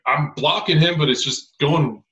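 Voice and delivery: high, loud helpless protest; exasperated. Retold or imitated. Rookie blocker crushed by an All-American, exasperated plea. Retells own helpless complaint.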